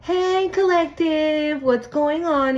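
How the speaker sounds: high, slow sugary ex voice; hammed-up fake friendly hello; mock sweet greeting. Ex calling the collective, mock sweet greeting. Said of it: "this person could have been like".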